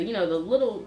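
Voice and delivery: usual speaking voice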